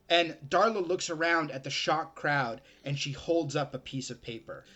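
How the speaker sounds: usual speaking voice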